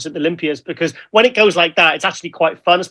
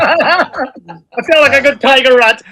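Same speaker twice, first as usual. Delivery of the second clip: laughing; 6 semitones higher, 6 dB louder, slower than usual. Real, not acted. Laughing while joking after his rant.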